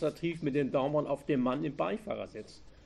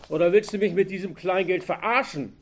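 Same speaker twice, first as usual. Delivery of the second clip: higher, louder furious shout; angry yelling; 5 semitones higher, 8 dB louder than usual. A narrator's reading. Robber berating the cashier over small change, angry yell. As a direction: rage at being cheated. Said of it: "schrie der Bankräuber".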